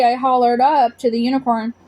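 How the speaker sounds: plain reading voice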